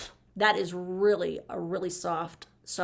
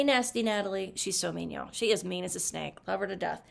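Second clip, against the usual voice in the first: normal talking voice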